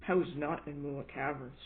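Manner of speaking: ordinary delivery, nothing marked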